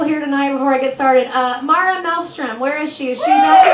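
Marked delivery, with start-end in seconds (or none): 3.2-3.7 high shout across the room; calling out. Real, not acted. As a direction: call loudly to the back of the hall. Calling out into crowd.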